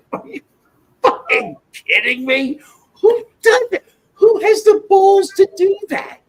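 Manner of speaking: high, loud incredulous outburst breaking into laughs; outraged disbelief. Real, not acted. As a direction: rant in shocked outrage, half laughing. Outraged rant about someone's nerve.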